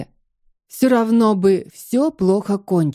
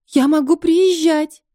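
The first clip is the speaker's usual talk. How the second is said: tearful plea; 8 semitones higher than usual. Acted. Pleading on the phone.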